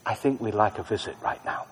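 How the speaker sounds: soft murmured prayer; barely voiced plea; under my breath. Shown as a demonstration. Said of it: "I say under my breath".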